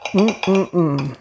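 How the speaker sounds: low pleased mm-mm-mm; savoring hum. Real, not acted. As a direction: hum while chewing. Tasting the bread.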